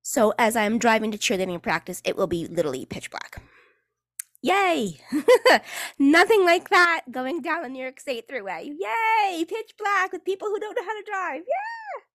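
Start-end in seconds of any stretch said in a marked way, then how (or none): 4.4-6.0 high mock cheer; high-pitched sarcastic whoop. Sarcastic cheer about dark drive. 6.0-12.0 high squealing fake enthusiasm; sarcastic glee. Sarcastic glee about dark drive.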